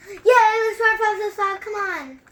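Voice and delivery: high, loud, bouncing with energy; excited little brother urging everyone on; hyperactive kid. Retold or imitated. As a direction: shout it like a kid who can't sit still. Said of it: "like, hyperactive".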